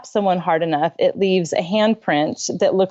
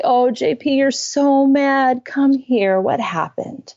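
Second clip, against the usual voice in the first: put-on slow tender soothing of an angry child; 5 semitones higher, slower than usual. Mom soothing furious son, tender and slow. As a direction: soothe him as if he were physically hurt. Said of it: "I respond like I do when he's physically hurt".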